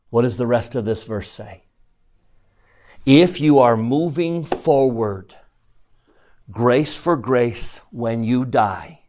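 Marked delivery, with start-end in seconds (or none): 3.0-9.0 "If you are moving forward, grace for grace" drawn out slowly; slow each phrase right down so it sinks in; slow emphatic repeat. Shown as a demonstration. Teacher to class, slowly stressing the promise. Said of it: "So let me say it again".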